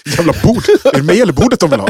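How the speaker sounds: high, fast complaining outburst; fake-fume about the table; hammed-up frustrated rant; mock frustrated rant. Friend voicing frustrated self.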